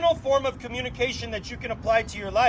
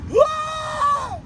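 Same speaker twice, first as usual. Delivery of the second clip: high exaggerated yelp; imitating an overwhelmed person's outburst; mock shout. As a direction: burst out with a high startled whoa. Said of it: "people are just like this".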